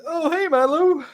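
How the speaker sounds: groggy shopkeeper voice. Acted. Worn-out shop owner protesting to his friend, high and tired. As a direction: beg off, still recovering. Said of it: "turns around".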